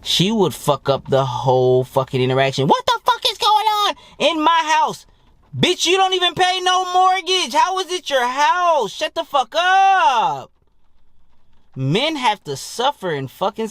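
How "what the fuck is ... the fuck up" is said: very high, drawn-out mock screech; high-pitched mock tirade, stretched out; outraged wife. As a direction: play an outraged wife in a squeaky falsetto. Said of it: "the wife fucking walked in".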